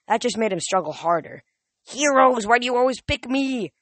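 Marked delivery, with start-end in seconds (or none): 1.8-3.7 high, loud exasperated complaint; whiny old man. Acted. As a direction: whine in exasperation. Nereus complains while struggling.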